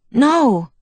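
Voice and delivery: sharp loud high no of protest; surprised protest. A narrator's reading. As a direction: blurt it out in alarm. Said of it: "he protested, surprised by this conclusion".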